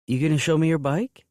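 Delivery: put-on high, girlish and hopeful. A narrator's reading. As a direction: pitch it up high and coy. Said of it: "her voice high".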